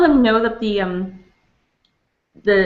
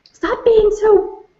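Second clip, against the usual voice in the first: exasperated retort; 6 semitones higher than usual. Retold or imitated. Customers scold her for over-politeness.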